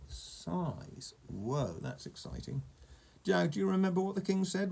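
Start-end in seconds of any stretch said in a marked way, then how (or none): 3.3-4.7 high-pitched goblin warning; lightly voiced caution, pitched up. A narrator's reading. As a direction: remind him pointedly. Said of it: "warned the goblin".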